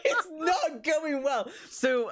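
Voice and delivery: high, slow protest through helpless laughter; laughing exasperation. Real, not acted. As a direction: curse them while cracking up. GM cracks up as accent fails.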